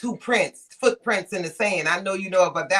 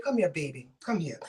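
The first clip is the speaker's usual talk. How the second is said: soft, lower motherly coaxing; tender mom; 4 semitones lower, 6 dB quieter than usual. Retold or imitated. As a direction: coax the child gently like a mother. Mom voiced comforting child.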